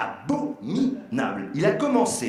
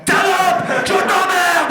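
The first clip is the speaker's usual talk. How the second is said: much higher, louder insult, hammed-up; mock furious yell; 14 semitones higher, 9 dB louder, much slower than usual. Mimicking the guy turning on his partner in a mock furious yell. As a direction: flip into a screaming rage. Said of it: "se met à hurler".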